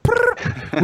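short high rolled trill; playful rolling sound effect; high trilled prrr. Pretend. Makes a trilled noise again.

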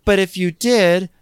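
hammed-up indignant Whitney; mock Whitney.

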